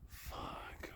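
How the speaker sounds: barely voiced hushed curse; whispered swear. Real, not acted. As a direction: breathe the swear out. Fire coming closer.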